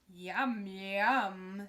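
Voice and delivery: loud wolf; loud hungry wolf. Acted. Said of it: "said the wolf".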